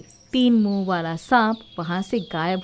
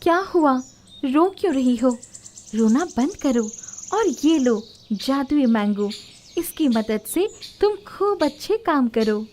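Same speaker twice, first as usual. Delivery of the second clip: higher, lightly put-on voice of the tree; kindly tree spirit; 3 semitones higher, 3 dB louder than usual. Tree comforting the weeping Komal, lightly voiced spirit. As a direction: soothe the crying girl gently.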